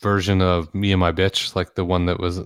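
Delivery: ordinary talk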